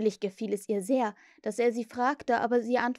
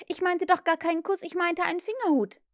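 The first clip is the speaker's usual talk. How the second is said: put-on high girl's voice, correcting herself; 9 semitones higher, 4 dB louder than usual. A narrator's reading. Wendy explains the thimble to Peter, high and insistent. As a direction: raise the pitch and put him right.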